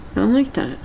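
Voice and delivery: ordinary talk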